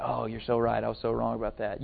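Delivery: low, very soft put-on contrition; mock meek apology. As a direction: murmur a submissive apology you don't mean. Mock meek apology, then "Usually not.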